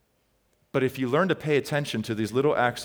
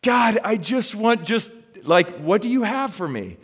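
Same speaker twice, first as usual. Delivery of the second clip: high, loud exasperated plea; reenacted fed-up prayer, pitched way up; frustrated, annoyed; 10 semitones higher, 5 dB louder than usual. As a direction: act out pleading with God in frustration. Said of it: "I'm so annoyed. I'm like".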